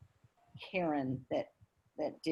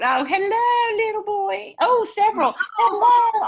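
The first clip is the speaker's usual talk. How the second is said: very high, loud coo of hello; 12 semitones higher, 10 dB louder than usual. Real, not acted. Host greets a friend's babies on camera, high cooing. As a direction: coo at the little ones like a delighted aunt.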